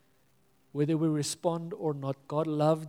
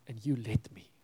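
whisper; 4 semitones lower than usual. Retold or imitated. Imagined friend's sad reproach.

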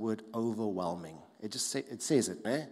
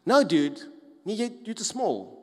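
mocking dismissal; 8 semitones higher, 8 dB louder than usual. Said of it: "the guys go like".

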